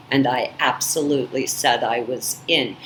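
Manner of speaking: normal talking voice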